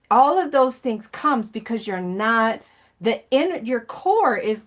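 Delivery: ordinary talk, nothing marked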